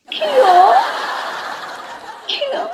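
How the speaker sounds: high, sharp double take; shocked what. Acted. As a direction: gasp it out in disbelief. Burger की खबर पर हैरानी. (Burger सुनकर चौंककर ऊँची आवाज़ में)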